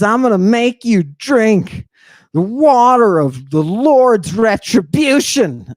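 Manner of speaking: high, slow, thundering mock proclamation; priest's pronouncement. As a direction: intone it like a priest passing judgment. Acting the priest pronouncing a judgment.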